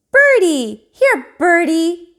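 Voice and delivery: put-on high loud toddler call; calling birdie. A narrator's reading. Fudge calling to the pigeons in a high toddler voice. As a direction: coax the pigeons like a small child. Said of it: "he called".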